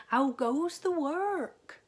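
high, loud, slow bellow from bed; lazy farmer calling. A narrator's reading. Lazy farmer calling from bed to the duck, high loud bellow. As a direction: boom it out like a lazy boss.

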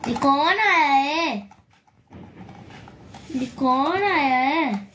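slow singsong question with long vowels; drawn-out cooing. Real, not acted. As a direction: stretch it out playfully for a pet. Coaxing talk to pet birds. (लंबा खींचकर, लाड़ भरा सवाल)

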